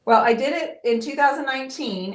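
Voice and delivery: usual speaking voice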